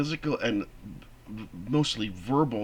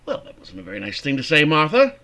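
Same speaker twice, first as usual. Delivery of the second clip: raised, louder scolding of wife; irritated reproach; 4 semitones higher, 7 dB louder than usual. Acted. Husband rebukes his wife, sharp and irritated. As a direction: snap back at Martha with an edge. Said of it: "Did you see any anger there?".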